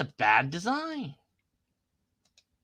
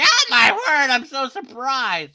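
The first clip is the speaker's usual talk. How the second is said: high, loud mock gasp of surprise; surprised figure; 6 semitones higher, 5 dB louder than usual. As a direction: voice the startled picture as a hammed-up shock. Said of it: "He's, like, coming out like".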